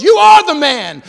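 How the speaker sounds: shouted, high and loud; booming prophet's reveal; dramatic accusation. Acted. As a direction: nail him with the accusation.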